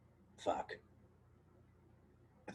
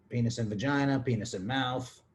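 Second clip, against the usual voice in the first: normal talking voice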